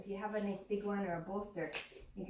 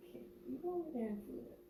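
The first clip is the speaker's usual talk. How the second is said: talking to the dog; 7 semitones higher, 4 dB quieter, faster than usual. Real, not acted. Shooing her dog away.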